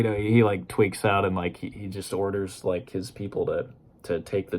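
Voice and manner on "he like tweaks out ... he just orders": ordinary delivery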